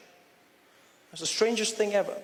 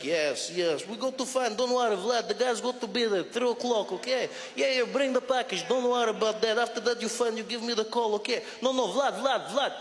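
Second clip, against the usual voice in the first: put-on Russian accent phone call; 3 semitones higher than usual. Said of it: "He's just like".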